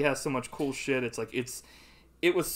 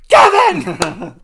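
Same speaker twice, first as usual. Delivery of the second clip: shouted name; 19 dB louder than usual. Retold or imitated. Calling out in a retold scene.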